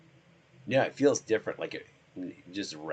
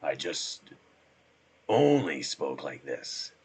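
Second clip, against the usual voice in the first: slow, lowered, showy voice; put-on deep voice; 3 semitones lower, 4 dB louder, slower than usual. Shown as a demonstration. Man demonstrating his possible new voice, slow and deep. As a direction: lean on the low register. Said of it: "this became my normal speaking voice".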